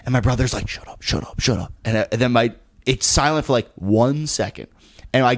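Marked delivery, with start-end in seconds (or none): 0.7-1.7 repeated urgent whispered hush; frantic whisper. Retold or imitated. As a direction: whisper it fast, desperate for quiet. Brother hushing the laughing dad.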